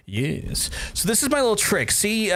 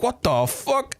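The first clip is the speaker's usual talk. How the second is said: shocked; 9 semitones higher, slower than usual. Retold or imitated. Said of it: "And I was like".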